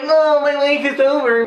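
mock despair. Retold character's dramatic outcry.

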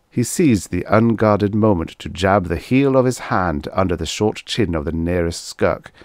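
plain reading voice

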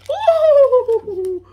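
high, loud, laughing "ooh"; big high-pitched whoop; excited whoop. Real, not acted. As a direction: squeal with delight at the reveal. Reveal of the next car.

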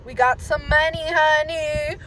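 loud, high crowing brag; celebrating. Real, not acted. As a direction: sing out your win, loud and high. Celebrating money earned at club.

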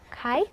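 "카이" as one gliding syllable, stopped short; demonstrating "카이". Shown as a demonstration. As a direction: breathe out the k, glide to a stopped end in "카이". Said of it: "요렇게 읽어주셔야 돼요".

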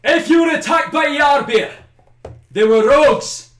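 high, loud call across a battlefield; shouting. Acted. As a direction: shout it out to the enemy leader. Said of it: "He shouts out".

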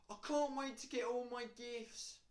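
high, loud mock excitement; gleeful anticipation. Someone looking forward to all the gifts, mock-excited. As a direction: gush with put-on glee. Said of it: "You'd be like".

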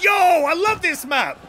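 high, slow, gushing mock delight; acted excited prisoner. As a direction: play a condemned man thrilled by the scenery. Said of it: "speaking from the perspective of the guy".

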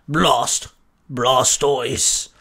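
far-off monster grunt; creature grunt. A narrator's reading. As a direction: grunt a yes like a big turtle. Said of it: "Blastoise grunted".

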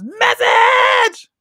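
high loud shout; movie quote shout. Retold or imitated. Host shouting the movie baby's line. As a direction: yell it like the baby in the crib. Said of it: "he says".

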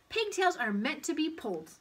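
put-on high, loud child's protest; defensive boy. A narrator's reading. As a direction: defend yourself to the teacher. Paul states his conclusion.

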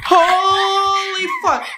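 slow, high, drawn-out shock; stunned disbelief. Real, not acted. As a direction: drag it out in disbelief. Shocked by monster.

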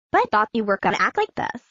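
fast, defensive; rushed excuse. Acted. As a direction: blurt it out quickly.

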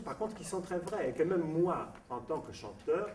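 usual speaking voice

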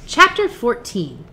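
plain reading voice

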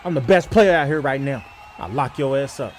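ordinary talk

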